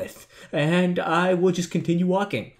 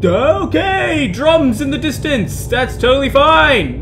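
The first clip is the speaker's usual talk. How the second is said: high, drawn-out startled yelp; 10 semitones higher, 3 dB louder, slower than usual. Real, not acted. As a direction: feign calm in a high, strained pitch. Startled by drums in game.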